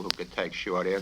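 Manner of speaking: ordinary delivery, nothing marked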